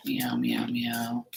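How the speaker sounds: soft breathless meows, barely voiced; whispered meows. Whispering meows to the stray cat he misses. Calling to his missing cat friend.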